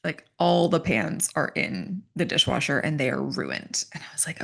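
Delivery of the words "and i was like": whispered aside. Real, not acted. Telling how she reacted, in a whisper. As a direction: breathe the words out softly.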